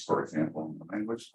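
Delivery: usual speaking voice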